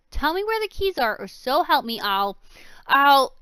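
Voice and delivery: high furious yelling. Acted. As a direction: yell it, losing control. Villain shakes her harder.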